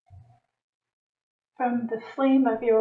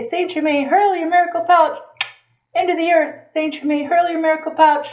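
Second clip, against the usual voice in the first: raised, ringing call to Saint Germain; fervent decree; 4 semitones higher than usual. Shown as a demonstration. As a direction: call it out with fervor. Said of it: "You say".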